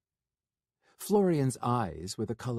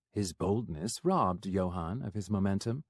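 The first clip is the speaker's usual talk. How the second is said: usual speaking voice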